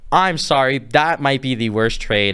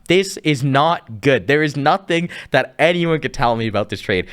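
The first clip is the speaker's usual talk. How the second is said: ordinary talk, nothing marked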